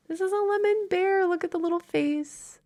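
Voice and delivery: high cooing voice for a cute plush; cutesy baby voice. Acted. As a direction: coo it like showing off a tiny toy.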